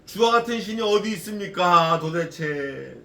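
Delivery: loud, drawn-out cry to God; Micah's crying out. Acted. Micah appeals to God amid enemies at home, crying out loudly. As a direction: cry it out, stretching the last word. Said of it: "그럴 때 미카야가".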